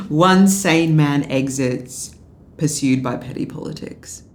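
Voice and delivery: slow, loud, hammed-up headline voice; mock grand reading. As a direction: read it like a pompous tribute, mocking.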